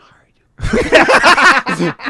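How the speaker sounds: laughing hard, high and loud, through the words; can't hold back the laughter. Real, not acted. Telling the hosts about the audition, laughing hard.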